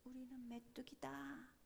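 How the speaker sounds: very soft, acted cowed murmur; hushed, shrinking mock confession; retold timid defeatism. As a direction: say it small, as if imitating the defeated. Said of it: "거기 있는 사람들은 뭐라고 말했어요".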